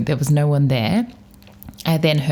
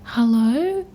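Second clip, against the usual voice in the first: high, small scared hello; a nervous questioning hello; hello squeaked into the dark; timid hello; 8 semitones higher than usual. Retold or imitated. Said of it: "such a timid hello".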